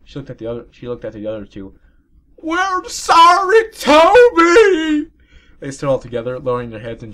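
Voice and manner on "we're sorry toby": very high, loud, slow shout; shouted apology. Acted. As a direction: yell it out together. Fillies shout in unison.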